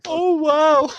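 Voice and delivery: high, loud burst of surprise; a sudden high "wow" of real amazement; amazed. Real, not acted. Streamer reacting to actor's life story, amazed.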